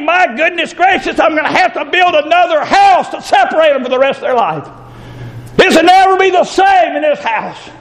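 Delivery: high, exasperated inner thought; fed-up father about his fighting kids; exasperated parent. Retold or imitated. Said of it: "I think".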